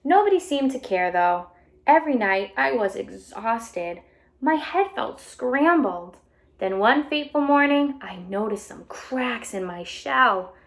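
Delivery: bright storybook read-aloud voice; animated picture book reading; reading to children. A narrator's reading. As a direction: read it out to a class of kids.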